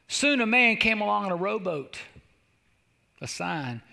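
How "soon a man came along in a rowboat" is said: ordinary talk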